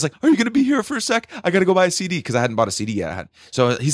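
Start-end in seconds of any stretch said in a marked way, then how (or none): 0.2-2.2 high, hurried imitation of himself asking; retold fanboy eagerness; eager request. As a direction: ask your idol in a rush, voice pitched up. Retold eager request to his idol.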